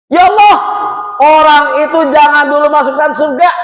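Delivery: high, loud, urgent protest; hammed-up complainant demanding justice; acted outcry to God.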